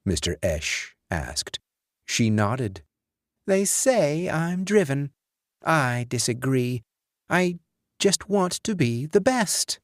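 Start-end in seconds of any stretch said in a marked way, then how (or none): 3.5-9.8 put-on lightly raised young woman's voice; higher, light female character voice. A narrator's reading. Kim voiced higher by narrator.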